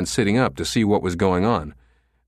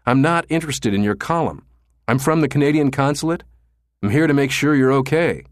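urgent truce; 6 semitones higher, 3 dB louder than usual. Acted. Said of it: "urgently signaling truce".

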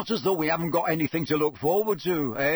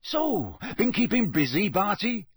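higher, bouncy casual question; jovial, pitched-up small talk; breezy; 7 semitones higher, 4 dB louder than usual. A narrator's reading. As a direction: ask lightly, without a care. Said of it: "said Bagman breezily".

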